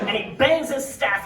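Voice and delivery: ordinary talk, nothing marked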